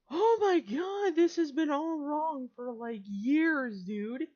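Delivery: high, loud, slow oh my god; real disbelief, voice shooting up; stunned loud exclaim; shocked outburst. Real, not acted. Shocked at map error.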